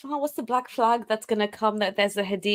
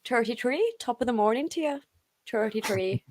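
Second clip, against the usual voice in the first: Irish lilt with t for th; Irish accent. Shown as a demonstration. Trying out Irish R's for friends, Irish accent. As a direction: greet them in a playful Irish lilt. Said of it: "like, the Irish".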